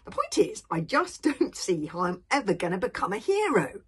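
put-on exasperated young gloom; gloomy.

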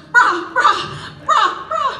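very high, loud bleat of bra; the goat trying to speak, only bleating. Acted. Goat can only bleat now.

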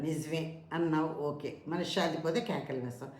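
understated, low-voiced agreement; mild concession. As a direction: concede the point quietly before objecting. Guru grants the disciple's answer.

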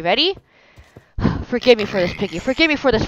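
usual speaking voice

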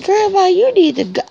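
puppy voice. Pretend. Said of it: "He said".

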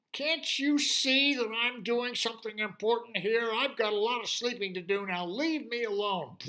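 loud, high, grumbling pig voice; grumpy pig. Acted. Lazy pig snapping at the unicorn, loud and high. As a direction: shove off the pest, gruffly annoyed.

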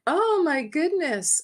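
a high, dismayed oh my goodness; horrified gasp. Retold or imitated. Hearing the angel's warning, horrified and high. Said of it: "I was just horrified".

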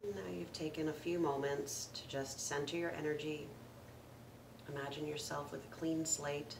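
calm meditation guide with long pauses; guided meditation. Acted. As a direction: gently lead listeners through the breath, unhurried, letting each phrase settle. Leads a breathing meditation.

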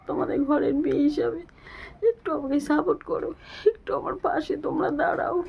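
high, strained voice full of grief; a sick mother begging viewers for support, tearful; tearful appeal. Real, not acted. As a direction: plead with viewers as if near tears.

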